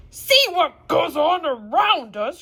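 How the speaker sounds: loud, slow, scornful outburst; indignant cry. Acted. Harpooner scoffs at the professor's calm, crying out loud and slow. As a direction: throw the words back at him, loud and slow. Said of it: "cried the harp".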